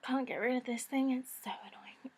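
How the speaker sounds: hoarse near-whisper; breathy croaky whisper. Real, not acted. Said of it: "still with her croaky voice".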